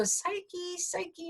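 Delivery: very high, soft, thin calling of a name; a tiny reed piping her name from the grass; thin little voice. A narrator's reading. Magic reed calling out to Psyche, in a high thin soft voice. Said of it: "a little thin voice go".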